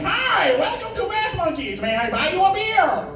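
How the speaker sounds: high-pitched cartoon villain yell; over-the-top villain screech, very high; Skeletor. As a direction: greet a customer like a shrieking supervillain. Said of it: "Why is Skeletor yelling at me".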